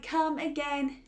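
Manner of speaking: ordinary delivery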